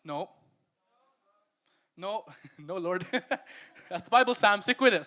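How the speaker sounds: loud, laughing self-correction; loud self-rebuke. Real, not acted. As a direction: tell yourself off loudly.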